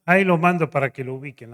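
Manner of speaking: lightly voiced pastor, brisk. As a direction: toss it off quickly like the pastor. Pastor hands over his son.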